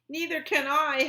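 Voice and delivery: high, loud wail; howled. A narrator's reading. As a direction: howl it in alarm. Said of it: "howled Flitter".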